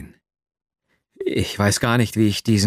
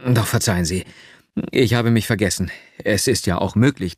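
plain reading voice

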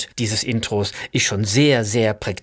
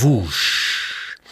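long hissing spaceship whoosh; imitated whoosh. Musician demonstrating the sound of a passing spaceship, imitated whoosh. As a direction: let the sh rush out and trail off. Imitiert das Geräusch vorbeifliegender Raumschiffe.

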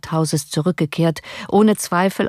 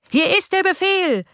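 a high jeering chant of many; insolent crowd chorus; 13 semitones higher than usual. A narrator's reading. Hundred insolent voices answering the count, jeering chorus. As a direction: taunt like a cheeky mob. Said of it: "antworteten hundert freche Stimmen im Chor".

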